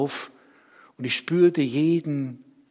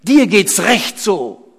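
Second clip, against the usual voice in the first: laut und hoch herausgeschrien, gedehnt; wütendes Schreien; 8 semitones higher, 12 dB louder than usual. Acted. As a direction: beschimpfe ihn schreiend vor der Menge. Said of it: "schrien einige". (wütend geschrien)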